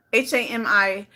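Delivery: usual speaking voice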